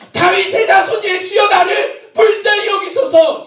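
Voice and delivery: very high, sustained cry; shouted plea. Acted. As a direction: keep shouting for mercy. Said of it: "이렇게 소리를 질렀는데".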